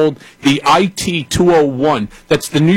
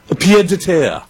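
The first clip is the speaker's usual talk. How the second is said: snooty French accent; 2 semitones higher than usual. Shown as a demonstration. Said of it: "say it with that snooty accent".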